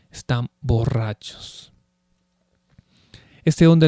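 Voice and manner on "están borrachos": Están borrachos dropped much softer; softer repeat. Shown as a demonstration. Preacher echoing the mockers' charge, hushed aside. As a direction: let Están borrachos fall away, hushed. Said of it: "repeated slower".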